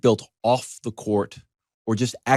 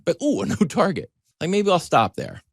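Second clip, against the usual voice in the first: tempted; 8 semitones higher than usual. Retold or imitated. Mock temptation by a Target.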